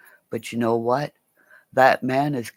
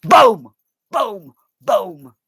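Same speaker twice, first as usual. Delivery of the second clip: loud high "Boom!" shouted three times; 13 semitones higher, 8 dB louder, slower than usual. Real, not acted. Streamer opening his live rant with a booming shout. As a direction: belt out "Boom!" like an opening bang.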